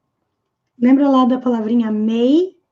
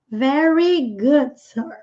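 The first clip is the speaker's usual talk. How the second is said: slow model reading; much slower than usual. Shown as a demonstration. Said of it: "teacher models the line slowly".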